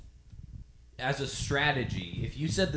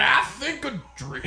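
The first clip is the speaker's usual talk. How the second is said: put-on Foghorn Leghorn accent; 9 semitones higher than usual. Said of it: "like, a Foghorn Leghorn southern accent".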